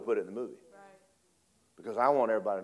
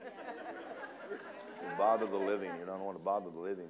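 hushed; 4 semitones lower, 7 dB quieter than usual. Retold or imitated. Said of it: "You're not supposed to disturb the living".

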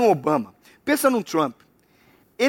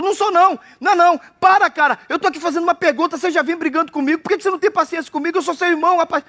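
put-on higher, fast childish protest; whiny little brother; 6 semitones higher, faster than usual. David protesting to his teasing older brother, whiny and fast. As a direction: plead like a wronged kid brother.

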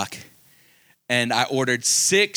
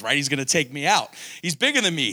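ordinary talk, nothing marked

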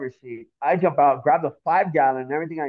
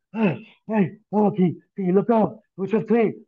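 acted panting, higher pitched; out of breath; 6 semitones higher than usual. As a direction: gasp out a request between breaths. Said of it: "I'm all out of breath".

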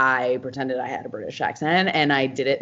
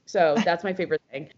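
ordinary delivery

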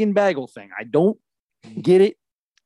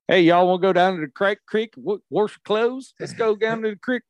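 put-on hillbilly accent; 5 semitones higher than usual. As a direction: call them down to the creek in a hillbilly accent. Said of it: "if somebody has an accent like that".

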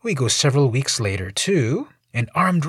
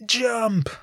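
high taunting yell of a crowd; mocking crowd chant, pitched high; 11 semitones higher than usual. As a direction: egg him on from below. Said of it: "The people below yell".

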